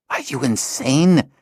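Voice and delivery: angry hiss. A narrator's reading. Cedar hissing at the intruder in his doorway. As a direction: hiss it at the late visitor. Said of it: "he hissed angrily".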